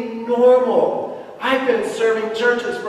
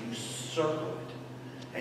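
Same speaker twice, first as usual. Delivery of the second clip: barely voiced whisper; 3 semitones lower, 17 dB quieter, slower than usual. Real, not acted. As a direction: drop to a breathy hush. Whispered aside in sermon.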